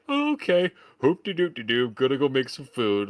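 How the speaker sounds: high, singsong, oblivious mock voice; mock carefree. Host mocking characters who ignore the creepy visitor, carefree singsong. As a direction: toss it off as a clueless, carefree ditty.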